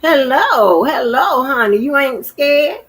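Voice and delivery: big, loud, high welcome; loud greeting. Real, not acted. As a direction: call out hello warmly and loud. Greeting a visitor loudly.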